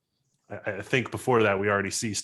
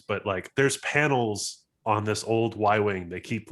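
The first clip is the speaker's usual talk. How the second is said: normal talking voice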